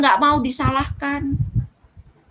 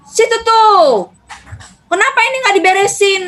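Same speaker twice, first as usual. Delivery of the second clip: higher, louder accusing snap; scolding husband; 7 semitones higher, 5 dB louder than usual. Retold or imitated. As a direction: snap at her like an annoyed husband. Husband scolds wife carelessly. (suami menegur istri dengan nada tinggi dan keras)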